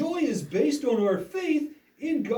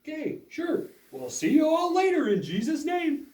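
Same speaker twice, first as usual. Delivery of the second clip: normal talking voice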